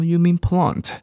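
put-on British accent with a high, questioning "plant"; British clerk correcting gently; British "plant" with a long ah; British waiter. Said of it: "他就说".

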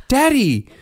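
put-on high-pitched indignant little boy; Bryn. Son to dad over a familiar toy, hammed-up outrage. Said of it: "Bryn immediately picks up a toy and goes".

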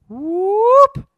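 very high, loud whoop; mock battle whoop. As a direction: yelp it out high. Fight breaks out.

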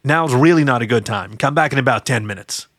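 ordinary talk, nothing marked

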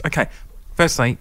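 usual speaking voice

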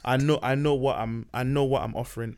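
normal talking voice

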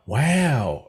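a light Owen Wilson-style drawn-out wow. Retold or imitated. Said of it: "the Owen Wilson wow".

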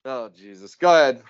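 plain reading voice

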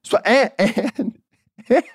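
high, breaking into laughter mid-word; real laughing. Real, not acted. Storyteller cracks up over the lasting feud, laughing. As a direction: try to get words out through laughter.

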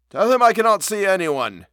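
high, loud, querulous voice; querulous refusal from within; complaining. A narrator's reading. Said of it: "it said complainingly".

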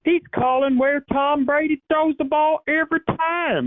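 mock country fan raving about a genius; thick country accent. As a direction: do the starstruck country fan at full tilt. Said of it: "a super thick country accent".

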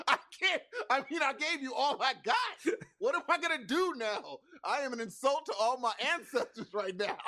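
high, loud comic lament; mock despair. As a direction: wail it as a hammed-up protest. Acts out character's helpless despair.

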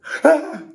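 sudden high laugh; high burst of laughter. Real, not acted. Laughing at getting weak on the ride, a high burst. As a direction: crack up at yourself.

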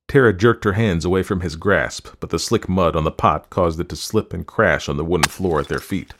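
plain reading voice

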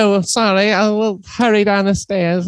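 high, put-on British accent, apologetic; a bad British accent, way up high; terrible British accent. The British woman apologizing, in a terrible British accent. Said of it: "That's a terrible accent".

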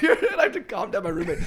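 ordinary talk

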